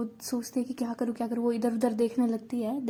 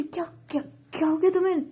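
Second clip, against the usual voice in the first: higher, urgent and frantic; panicked; 6 semitones higher than usual. Acted. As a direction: panic over a friend who is collapsing. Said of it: "panic करने लगती है".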